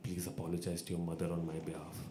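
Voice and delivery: low, soft imitation of a solemn official; baritone apology. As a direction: imitate a deep, hushed request. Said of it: "he gets his baritone voice out".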